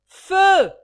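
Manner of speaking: un seul mot hurlé très haut; crié. Acted. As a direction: ordonne le feu d'un cri. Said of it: "Ragdal de crier". (cri très fort)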